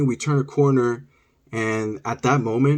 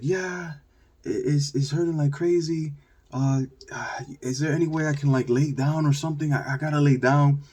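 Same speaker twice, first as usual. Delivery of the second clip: higher, feeble mock-pained voice; put-on suffering; 4 semitones higher than usual. Hurt boy to neighbour lady, hammed-up pained plea. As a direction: play up the hurt to get sympathy. Kid playing up pain to lady.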